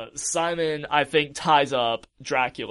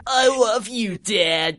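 high, drawn-out mock-tender reply; overdone father-son sweetness; gushing son; 6 semitones higher, slower than usual. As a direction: answer dad in a raised, hammy voice. Acts the son answering back.

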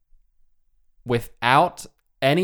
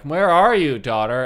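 raised, higher call across the house; 4 semitones higher, 6 dB louder than usual. Acted. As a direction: call out for the missing daughter. Ogre mom calling for missing daughter.

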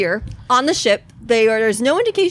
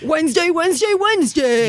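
high booming hype announcer call; monster truck announcer; 8 semitones higher than usual. Retold or imitated. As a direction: shout it like a monster truck rally ad. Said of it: "the voice of a monster truck announcer".

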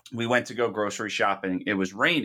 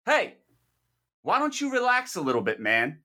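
calling out; 9 semitones higher than usual. Retold or imitated. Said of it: "So I said".

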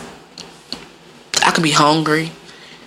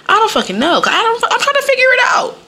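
exasperated. Real, not acted. Frustrated about own attitude.

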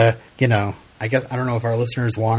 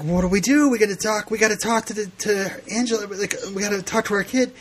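panicked mom; 8 semitones higher than usual. Retold or imitated. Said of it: "she's like".

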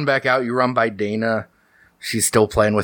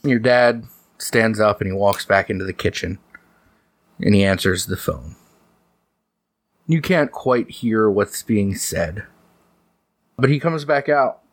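ordinary delivery

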